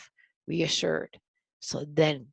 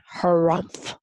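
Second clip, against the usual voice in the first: grumpy harumph; 6 dB louder than usual. A narrator's reading. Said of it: "He lowered his voice too, and he said".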